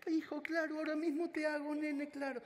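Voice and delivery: very high, eager imitating mother; overjoyed mother. Mother promising to cook for her son, very high delighted voice. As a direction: agree at once, delighted.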